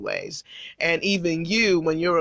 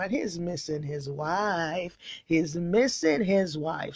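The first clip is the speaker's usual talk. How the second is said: soft pining; 6 dB quieter, slower than usual. Pretend. Pining king retold.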